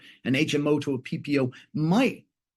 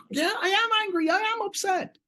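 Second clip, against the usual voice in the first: much higher, whiny upset voice; upset client. Retold or imitated. Angry client admitting upset, high mock whine.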